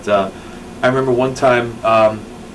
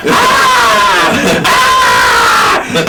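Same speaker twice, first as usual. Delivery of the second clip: womanly scream; 6 semitones higher, 7 dB louder than usual. Retold or imitated. Imitating furious Gabe on the phone, loud screams. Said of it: "he screams like a woman".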